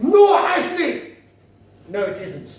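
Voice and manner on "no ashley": loud, high, drawn-out Glaswegian retort; guttural Glaswegian. Acted. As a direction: shout the contradiction in thick, slow Glaswegian. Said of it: "And then he said".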